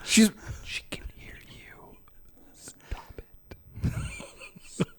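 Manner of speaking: slow, hammed-up embarrassed whisper; mortified whisper. Adam begging God to stop, mortified whisper. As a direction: whisper it desperately so she won't hear.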